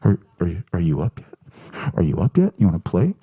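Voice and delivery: low, far-off pestering cat. Acted. Cat waking its owner at six in the morning. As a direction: nag like a cat wanting play at dawn. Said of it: "just that kind of".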